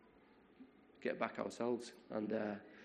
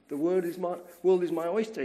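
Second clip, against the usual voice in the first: high, loud rush of freedom; elated thought; 7 semitones higher, 6 dB louder than usual. Retold or imitated. Arpad free after the war, loud high delight. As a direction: let the thought burst out full of possibility. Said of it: "he was thinking".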